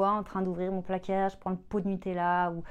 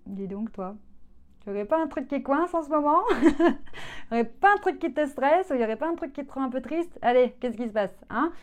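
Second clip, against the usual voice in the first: higher and louder, teasing and kind; gentle coaxing self-talk; 7 semitones higher, 7 dB louder than usual. Retold or imitated. Speaking kindly to herself while raiding the fridge, playful and coaxing. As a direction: coax yourself like a caring friend. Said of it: "tu te dis".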